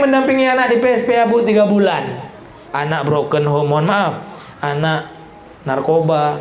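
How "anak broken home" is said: ordinary delivery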